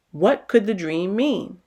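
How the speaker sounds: usual speaking voice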